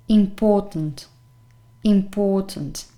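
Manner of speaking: important, stressed on the POR; im-POR-tant, second syllable carries the weight. Shown as a demonstration. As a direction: say important with a strong middle syllable. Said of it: "the stressed syllable is the second".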